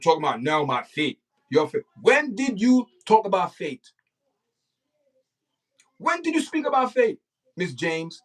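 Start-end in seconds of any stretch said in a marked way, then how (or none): 2.0-8.2 indignant. Real, not acted. Berating her about faith.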